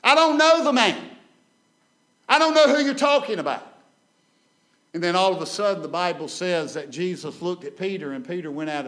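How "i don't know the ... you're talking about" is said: high, loud angry denial; cursing denial. Retold or imitated. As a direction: spit out the denial with a curse. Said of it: "began to lift up cursings and blasphemies".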